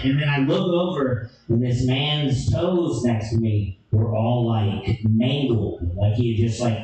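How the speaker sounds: ordinary delivery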